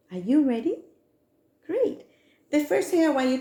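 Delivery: high, eager, bouncy; rally the class with glee; overbright kids party host. Acted.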